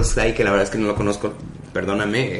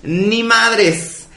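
indignant retort; 7 semitones higher, 5 dB louder, much slower than usual. Retold or imitated. Imitates Flor Rubio's retort.

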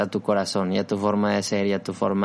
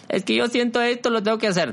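high mock voice; 11 semitones higher than usual. Mock quoting impulsive self.

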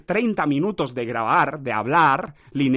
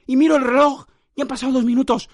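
raised, louder exasperation; exasperated; 8 semitones higher, 5 dB louder than usual. Real, not acted. Recording alone, staring at the clock, exasperated and loud. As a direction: complain in disbelief at the clock.

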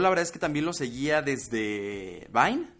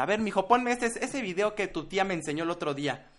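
higher-pitched señora asking for a video; 6 semitones higher than usual. Acted. Playing a señora who asks her boy for a video, señora voice. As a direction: put on a fussy auntie voice, raised pitch. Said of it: "yo soy como una señora".

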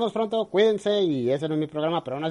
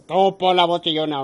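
louder insistent order; demanding producer; 3 semitones higher, 7 dB louder than usual. Retold or imitated. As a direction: bark the order like a boss. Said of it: "la obligan a hacer".

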